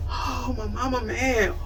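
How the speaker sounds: put-on soft, frightened little-girl murmur; scared whimper. Daughter cowering as her yelling mother walks in, soft scared murmur. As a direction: shrink back like a scared kid. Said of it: "I got scared".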